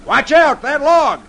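calling out. Acted. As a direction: yell the warning across water. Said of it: "he calls".

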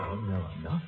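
very soft, low menace; menacing whisper. Acted. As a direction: deny it in a soft threat. Menacing denial to captive.

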